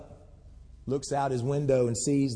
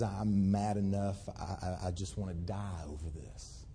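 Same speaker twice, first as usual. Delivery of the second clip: despairing; 4 semitones lower, 5 dB quieter than usual. Acted. Jonah voiced in despair.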